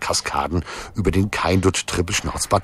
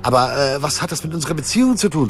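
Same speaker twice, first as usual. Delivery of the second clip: higher-pitched, exasperated questioning; annoyed; 6 semitones higher than usual. A narrator's reading. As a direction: demand an answer, fed up. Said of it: "fragte Liesel genervt". (genervt, mit erhobener Stimme)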